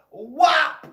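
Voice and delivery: loud, high "Whop!" hit sound. Retold or imitated. Kid telling the crowd how he hit the bully, whop sound effect. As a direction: smack the word out like a blow.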